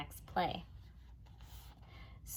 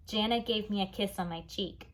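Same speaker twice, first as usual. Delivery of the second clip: usual speaking voice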